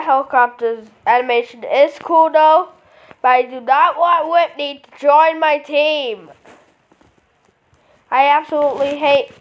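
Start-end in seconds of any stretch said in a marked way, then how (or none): none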